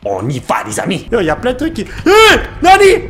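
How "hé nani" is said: sudden high yelp of surprise; startled shout. Real, not acted. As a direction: jump out of your skin. Startled by monster. (cri de surprise)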